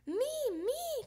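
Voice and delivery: high, squealed little plea to join; excited little one volunteering; eager cry. A narrator's reading. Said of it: "cried Polly Woggylina".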